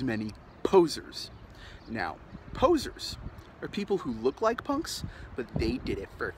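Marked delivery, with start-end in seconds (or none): none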